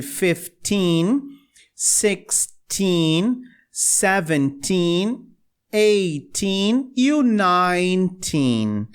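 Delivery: seventeen and eighteen said very slowly; slow teen drill. Shown as a demonstration. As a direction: stretch each number for students. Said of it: "A sílaba tônica é mais o teen". (bem devagar, tônica no teen)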